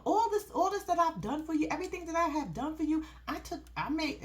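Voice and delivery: high-pitched mock grievance; guilt-tripping. Girlfriend tells boyfriend all she did for him, aggrieved. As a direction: imitate a girlfriend listing her sacrifices.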